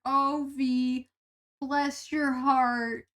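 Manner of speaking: slow, drawn-out Southern sweetness; a light Southern lilt, sugar-coated insult; Southern bless your heart. Retold or imitated. Imitating a chatter teasing OV with a slow Southern bless your heart. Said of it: "if any Southern person says that to you".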